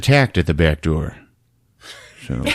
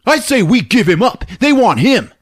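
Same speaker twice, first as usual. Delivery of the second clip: high, louder snapping back; high angry retort; 13 semitones higher, 3 dB louder than usual. Retold or imitated. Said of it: "Roach is like".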